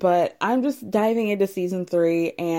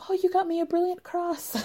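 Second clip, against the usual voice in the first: high, soft gushing over a gift; imitating delighted wife; 11 semitones higher, 3 dB quieter than usual. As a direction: coo over the shiny cross like she did. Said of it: "She's like".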